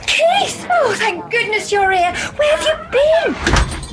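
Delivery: very high, fretful cry of relief; frantic relief. Acted. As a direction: greet the latecomer in a high-pitched fluster. Frantic greeting of late director.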